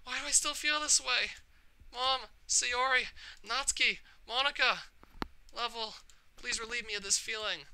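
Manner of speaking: sobbing out their names; tearful pleading. Acted. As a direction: beg through tears. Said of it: "burst into tears".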